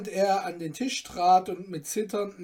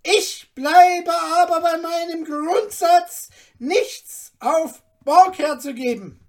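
much higher, louder rasping curt voice; 11 semitones higher, 11 dB louder than usual. Acted. Iron merchant cutting off the father, rasping. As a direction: snarl it off dismissively. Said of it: "mit schnarrendem Tone".

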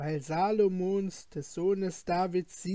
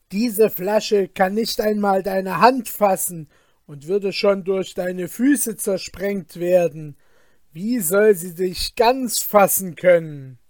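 doubting fisherman; 8 dB louder than usual. Acted. Fisherman argues loudly.

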